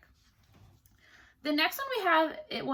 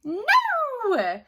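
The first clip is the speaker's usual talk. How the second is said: high, loud imp's cry; little man shrieks his refusal; 12 semitones higher, 7 dB louder than usual. A narrator's reading. Little man rejects queen's guess, high loud cry. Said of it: "cried the little man".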